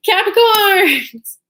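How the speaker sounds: loud, high, stretched-out cry; drawn-out exclamation. Real, not acted. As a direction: call it out long and loud. Mock lament to fellow Capricorns.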